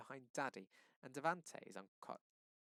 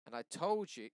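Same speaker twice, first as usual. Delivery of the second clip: defiant raised voice; 5 semitones higher, 8 dB louder than usual. A narrator's reading. Devante insists louder.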